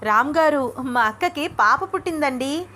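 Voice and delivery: high, excited announcement; bubbling over with joy; jumping for joy. Acted. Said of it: "ఎగిరి గంతులు వేస్తోంది".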